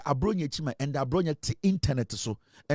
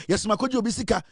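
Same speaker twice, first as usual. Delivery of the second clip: normal talking voice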